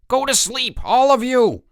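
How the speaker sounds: high, loud roar; bellowing order. A narrator's reading. Counselor bellowing at campers to sleep. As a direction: roar the order at the whole cabin. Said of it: "bellowed Chip".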